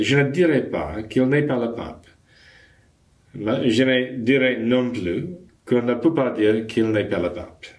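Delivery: put-on poorly accented French; terrible French accent. Quoting Monsignor Lefebvre on the Pope, in badly accented French. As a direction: recite French with a clumsy foreign accent. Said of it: "excuse my terrible accent".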